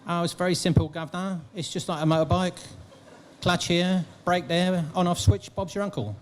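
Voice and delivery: British bodyguard voice. Retold or imitated. Bodyguard explaining the ATV controls in a breezy British accent. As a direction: rattle it off like a cheery British bloke. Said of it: "This guy came up to me and he goes".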